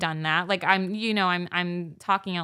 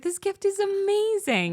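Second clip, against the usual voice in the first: excited praise; 13 semitones higher, slower than usual. Retold or imitated. Retelling praise to friend's gift.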